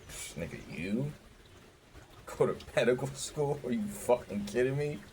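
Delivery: mocking counselor. Counselor mocking his med school plan, incredulous laugh. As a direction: scoff like it's a joke. Counselor's scornful reaction quoted.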